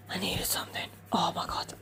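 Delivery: soft whispered echo; whispered repetition. Real, not acted. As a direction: repeat it hushed to yourself. Repeat in whisper.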